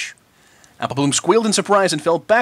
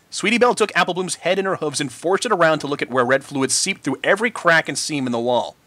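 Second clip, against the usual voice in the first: usual speaking voice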